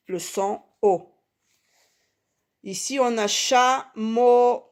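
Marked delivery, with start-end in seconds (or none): 3.3-4.6 CHAMEAU slow and high, cha and meau held; syllable by syllable. Shown as a demonstration. Teacher locating the O in chameau, syllable by syllable. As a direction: draw out both halves of "chameau". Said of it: "dans la deuxième syllabe".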